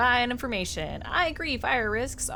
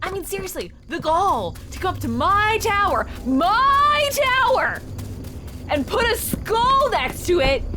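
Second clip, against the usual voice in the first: high, drawn-out indignant rant; outraged; 11 semitones higher, 7 dB louder, slower than usual. Real, not acted. Player fumes at the gall of the tower visitor, outraged. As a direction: stretch the key words in outrage.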